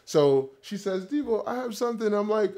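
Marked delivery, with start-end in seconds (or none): 1.0-2.1 a light Jamaican mother voice, pitched higher; Jamaican accent. Retold or imitated. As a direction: imitate the mother's Jamaican lilt. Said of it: "the accent that I'm trying to replicate".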